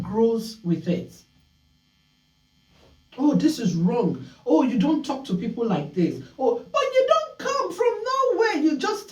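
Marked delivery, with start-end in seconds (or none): 3.2-9.1 a touch of reproach that pitches up sharply near the end; lightly voiced parent scolding, rising into a high squeal; mock scolding parent. Imitating a parent telling off a rude child, lightly played.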